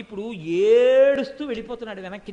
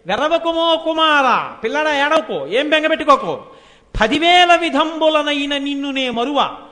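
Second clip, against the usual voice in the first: motherly reassurance; 3 semitones higher, 7 dB louder than usual. Acted. Goddess consoling crying child.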